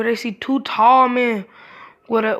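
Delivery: usual speaking voice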